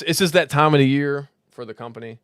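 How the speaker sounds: ordinary talk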